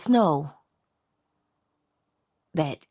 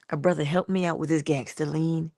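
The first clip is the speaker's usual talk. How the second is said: ordinary delivery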